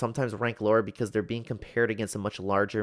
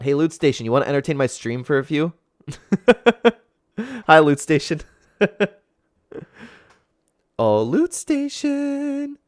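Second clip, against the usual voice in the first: playful calling; 5 semitones higher, 12 dB louder than usual. Real, not acted.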